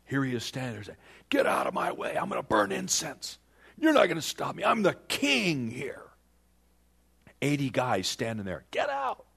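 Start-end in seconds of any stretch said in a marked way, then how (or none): 1.3-6.0 hammed-up royal outburst, climbing higher; angry. King defying the priests at the altar, acted anger. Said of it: "he was still angry with the priests". 8.7-9.3 sudden high-pitched order; acted command. Eighty priests standing up to the king, urgent command.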